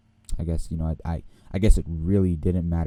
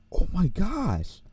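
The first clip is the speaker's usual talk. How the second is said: slow, much higher stunned exclamation; drawn-out amazed oh my gosh; shocked gasp; 11 semitones higher, much slower than usual. Retold or imitated. Student seeing papers thrown in the fire, slow high shock.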